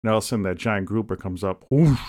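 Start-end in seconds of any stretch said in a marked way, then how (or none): 1.7-2.0 loud, higher-pitched whoosh; imitated whoosh. Telling of a grouper striking, a loud whoosh effect. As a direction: make the rush of a big fish surging up.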